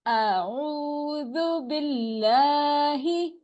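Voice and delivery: slow, stretched recitation; measured tajweed chant; Quranic recitation. Shown as a demonstration. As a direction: recite slowly with long vowels.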